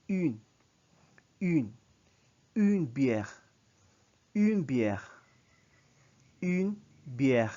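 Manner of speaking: une bière with rounded une, clear and loud; French pronunciation. Shown as a demonstration. Teacher drilling feminine article, emphatic pronunciation. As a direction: model une bière loudly for class. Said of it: "So you say".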